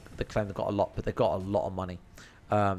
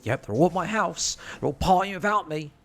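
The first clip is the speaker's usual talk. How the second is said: higher, louder, retelling his own angry thoughts; mock-angry paranoid rant, pitched up; angry inner voice; 7 semitones higher, 4 dB louder than usual. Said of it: "I got proper angry".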